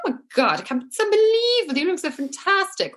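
high, gushing mock excitement; thrilled mother. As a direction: imitate an overjoyed mum, pitch leaping up. Mother's thrilled reaction acted out.

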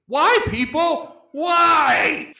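high, loud, drawn-out mock plea; exasperated shout. Reading a hater's review to co-host, exasperated high shout. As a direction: wail it out in hammed-up despair.

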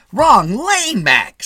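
high, loud gruff attendant. A narrator's reading. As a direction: bark the correction at him. Attendant yelling.

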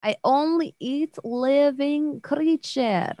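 slow, drawn-out monster voice, broken English; menacing spider. Acted. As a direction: savour each word like a hungry predator. Spider Widow threatens.

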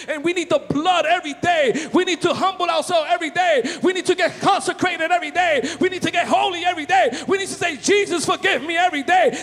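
loud, fervent preaching over music; full-force preaching. Real, not acted. Preacher shouting praise to the congregation, fervent.

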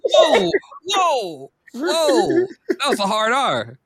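high, drawn-out whoas with laughter; amused alarm. Real, not acted. Co-host reacting to a hard R slip, laughing alarm. Mock alarm at co-host's word.

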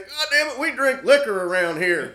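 put-on high, loud laughing drunk boast; hammed-up drunken bragging. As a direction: crow like a drunk regular. Jason boasting to waitress.

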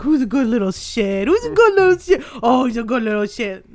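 high and loud cooing; doggy baby talk. Retold or imitated. Owner cooing praise at the dog Lonnie. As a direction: praise the dog in sing-song. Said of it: "Be like".

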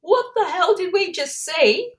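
high, loud yell of disbelief; re-enacted astonished shout; stunned yell. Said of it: "I think I just yelled out".

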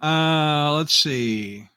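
ordinary talk

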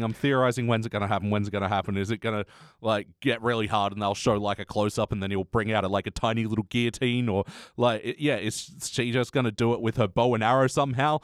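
normal talking voice

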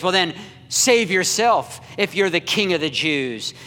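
loud, jeering challenge; mocking taunt. Crowd mocking Jesus on cross.

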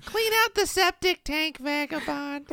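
very high, slow falsetto request; hammed-up squeaky plea to a hired helper; needy villager. Plays villager giving a job.

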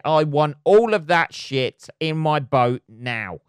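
hammed-up colonist laying claim to the loot; mock colonial conqueror.

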